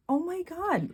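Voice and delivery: high, stunned oh my god; amazed gasp. Retold or imitated. Retelling finding daughter's gift: high astonished exclamation. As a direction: squeal it like you just saw the gift. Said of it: "and I was like".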